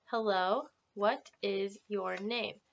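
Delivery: slow, clear teacher modelling; slow model phrase. Shown as a demonstration. Teacher modelling a question slowly for students. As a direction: spell it out slowly for the class. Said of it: "everyone can repeat that with me".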